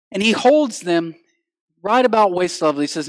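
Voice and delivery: ordinary delivery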